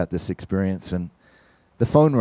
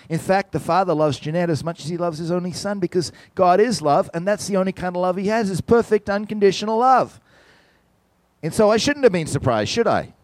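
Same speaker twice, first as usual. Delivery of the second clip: higher, building fervent preacher voice; rising preaching; 8 semitones higher than usual. Acted. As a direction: build to a passionate peak on love.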